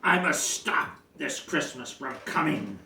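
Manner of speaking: ordinary delivery, nothing marked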